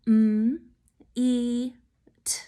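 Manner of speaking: "M, E, T" as separate sounds, mmm, ee, t; sounded out. Shown as a demonstration. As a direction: say each sound of meet alone with pauses. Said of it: "break it apart with me".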